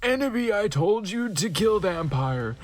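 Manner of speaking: high, theatrical evil lord; villain scolding his failed minions. Acted. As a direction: ham up a dark lord threatening. Voldemort scolding servants.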